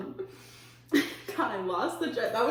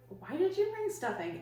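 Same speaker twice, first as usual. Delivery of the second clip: mildly mean, high-pitched retold question; retelling your own mean question, voice raised in pitch; kinda mean; 10 semitones higher than usual. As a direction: ask it with a mean edge, pitched up. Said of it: "I kinda said it kinda mean".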